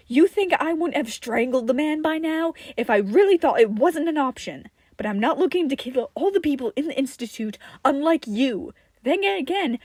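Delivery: loud, furious accusation. Acted. Tim furiously accusing Johnny of not caring about death. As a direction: tear into him, loud and bitter.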